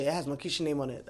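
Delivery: normal talking voice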